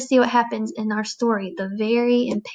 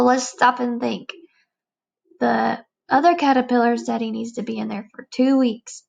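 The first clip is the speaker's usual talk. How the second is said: plain reading voice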